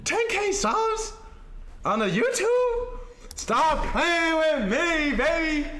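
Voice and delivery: high, slow amazed disbelief; gym bro. Real, not acted. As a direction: stretch it out in happy shock. Disbelief at hitting ten K.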